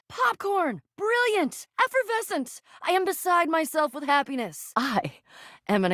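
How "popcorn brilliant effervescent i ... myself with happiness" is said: high-pitched bursts of delight; overjoyed. Acted. Beside oneself with happiness about the special house, overjoyed. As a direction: exclaim each word as if overjoyed.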